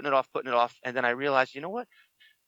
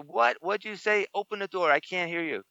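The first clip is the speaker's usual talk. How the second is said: calling through the door; 8 semitones higher than usual. Retold or imitated. Yelling through shower door.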